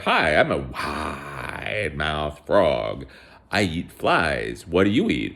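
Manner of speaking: slow, stretched-out frog greeting; cartoon frog introducing itself, slowly; wide-mouthed frog. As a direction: draw out the WIDE like a storybook frog.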